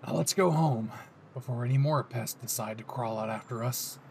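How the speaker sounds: low, smug possessive tenderness; a hint of a satisfied yandere; lightly voiced Bakugo.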